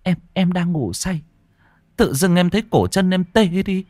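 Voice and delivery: high, trembling wife retelling; terrified. A narrator's reading. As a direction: tell it pitched high, still shaking with fear. Terrified wife recounts to husband. (vợ run sợ kể lại cho chồng, giọng cao)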